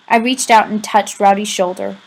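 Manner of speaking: plain reading voice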